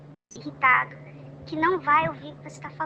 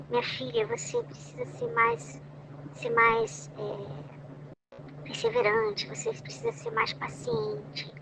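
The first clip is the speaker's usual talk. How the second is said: higher, soft and slow, tender; gentle Holy Spirit; 8 semitones higher, 5 dB quieter, much slower than usual. Pretend. The Holy Spirit speaking lovingly to a daughter, soft and tender. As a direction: coax a daughter kindly, gently. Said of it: "o tom dele, como ele fala com a gente".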